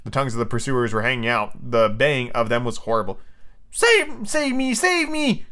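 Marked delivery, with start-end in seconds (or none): 3.7-5.5 high-pitched panicked storybook cry; acted squeal of alarm; crying for help. A narrator's reading. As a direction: cry out to be saved, voice way up. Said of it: "cried Nibs, falling on the ground".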